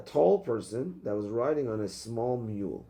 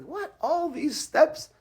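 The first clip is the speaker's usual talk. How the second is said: much higher, slow incredulous what and all these steps; mock disbelief; 4 dB louder, much slower than usual. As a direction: play it like you can't believe the detour. Mock disbelief at Hashem's roundabout way.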